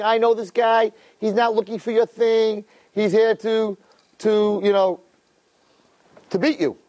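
high, sly accusing voice; scheming accuser. Retold or imitated. Accuser whispering to king.

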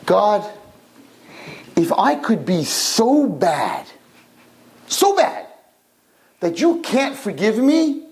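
challenge. Acted. Menashe's clever challenge to God.

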